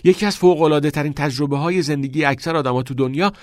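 bursting with enthusiasm; excited. A narrator's reading. Neil answering Todd, thrilled about acting, excited. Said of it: "هیجان زده پاسخ داد".

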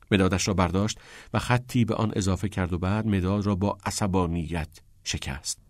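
plain reading voice

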